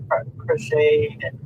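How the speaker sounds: usual speaking voice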